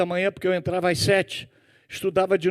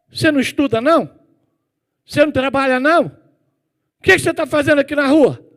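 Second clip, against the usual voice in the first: put-on higher and louder, a stern churchman calling out a boy; scolding deacon; 7 semitones higher, 6 dB louder than usual. Deacon catching the boy on the street, loud stern questioning. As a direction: call the truant boy over and question him hard.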